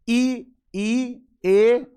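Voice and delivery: ई, ई, ए sounded one by one from the front of the tongue; front vowels. Shown as a demonstration. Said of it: "ई आगे से बोल रहा है". (ई, ई, ए जीभ के आगे से)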